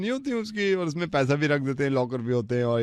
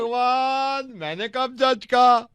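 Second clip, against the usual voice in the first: high, slow pleading "oh god"; exasperated cry; 10 semitones higher, slower than usual. Acted. Caller exasperated by the listener's accusation, high slow protest. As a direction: throw up your hands in disbelief.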